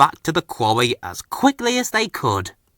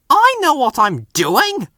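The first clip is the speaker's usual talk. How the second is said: sulky wheeze; 13 semitones higher than usual. A narrator's reading. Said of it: "wheezed Bill".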